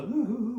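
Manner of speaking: high, wavering fake sob; put-on boo-hoo wail; mock sobbing. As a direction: imitate someone whimpering in tears. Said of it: "우는 거".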